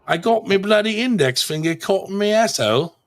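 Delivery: put-on a suggested sentence in a jokey London accent; mock London accent. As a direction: ham up a London geezer. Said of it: "I know that's a London accent".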